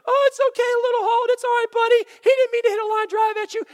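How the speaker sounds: high, loud, gushing mother's voice; fussing mom. Retold or imitated. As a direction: coo over him like a panicked mom. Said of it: "Mom comes running out of the stands".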